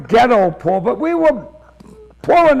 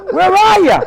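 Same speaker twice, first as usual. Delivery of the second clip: high, raised searching call; 9 semitones higher, 4 dB louder than usual. Retold or imitated. Teller calls to the unseen man in the dark, high shout. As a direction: call out to someone you can't see.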